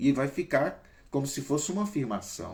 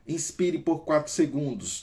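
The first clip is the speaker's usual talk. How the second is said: breathing coach; 4 semitones higher than usual. Shown as a demonstration. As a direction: count them through the breath in. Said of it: "guiding a breathing exercise".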